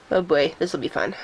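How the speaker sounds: ordinary talk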